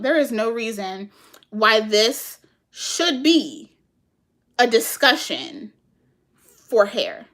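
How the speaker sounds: slow, spaced-out disbelief; exasperated. Retold or imitated. Telling viewers why the topic is wrong for hair, exasperated. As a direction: spell it out slowly, pausing between phrases. Acts out her exasperated reaction.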